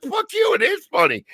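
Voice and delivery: very high-pitched comeback; mock indignant retort. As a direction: argue back high, in comic outrage. Retelling his angry comeback to James.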